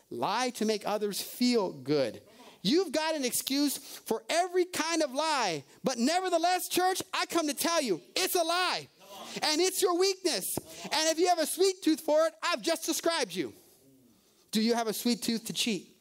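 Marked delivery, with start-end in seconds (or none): 2.6-13.5 higher, declaring it's a lie; preaching. Acted. Preacher telling the church lying is their weakness, declaring. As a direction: proclaim it firmly.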